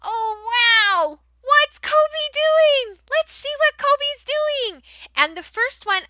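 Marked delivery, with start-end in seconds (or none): 0.1-4.8 much higher, slow, sing-song amazement; animated wonder. Shown as a demonstration. Teacher asking young learners about the animation, amazed. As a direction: make it a big exciting mystery.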